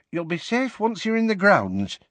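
Tonks voice. A narrator's reading. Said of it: "said Tonks".

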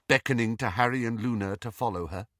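ordinary delivery, nothing marked

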